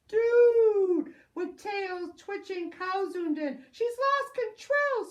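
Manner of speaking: loud, high panicked yell; screaming. Acted. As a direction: scream the warning. Pangolin screams warning.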